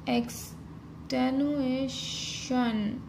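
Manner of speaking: एक्सटेनुएशन drawn out very slowly, each syllable stretched; slow dictation. Shown as a demonstration. Teacher writing the shorthand outline, saying it slowly. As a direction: stretch एक्सटेनुएशन out as you write it. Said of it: "इश और N hook".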